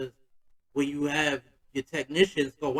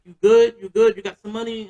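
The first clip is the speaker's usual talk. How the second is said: louder and higher concerned auntie; a worried aunt pressing her nephew, voice raised; aunt checking in; 6 semitones higher, 11 dB louder than usual. Retold or imitated. Aunt on the phone checking on her nephew, loud and higher. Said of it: "She like".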